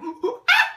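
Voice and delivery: high, loud mock elephant trumpeting; elephant trumpet call. Imitating the elephant on the track.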